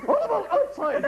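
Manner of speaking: sending the boy out, mock stern; stern teacher. Teacher ordering Oliver outside, high and mock stern.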